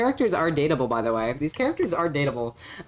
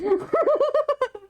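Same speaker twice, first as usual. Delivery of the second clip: shrill burst of giggles; high squeal laugh. Real, not acted. Streamer squealing with laughter after mispronouncing the show name. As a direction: laugh at your own dumb joke.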